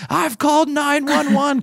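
put-on bellowing after the kids who threw the ice; unhinged mock yell at the culprits; furious shout. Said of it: "I literally lose my mind".

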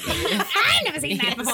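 Wicked Witch. Retold or imitated. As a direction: cackle through it like the witch from Oz. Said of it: "talks like the Wicked Witch".

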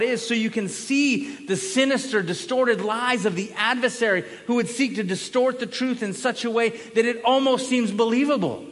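loud, rising preacher's build; fiery sermon crescendo; preaching at full force. Acted. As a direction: thunder it from the pulpit. Preaching exhortation.

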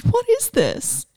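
high, slow and baffled; puzzled wonder. Retold or imitated. Asking her best friend, high and baffled. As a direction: ask as if truly lost. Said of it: "just so strange".

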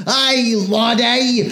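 high, slow Cockney that sounds Scottish; attempted thick Cockney. Imagined Cockney hailing a sensitive person, hammy botched Cockney. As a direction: overdo a botched Cockney accent. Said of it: "like, a thick Cockney accent".

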